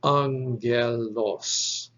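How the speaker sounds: angelos drawn out slowly, syllable by syllable; stretched teaching pronunciation of angelos; demonstrated pronunciation. Shown as a demonstration. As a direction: say angelos very slowly as ANG-gel-os. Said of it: "So this is pronounced".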